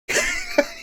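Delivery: high, soft mock laugh; very high-pitched put-on chuckle; imitated caller's laugh. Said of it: "and then he would laugh".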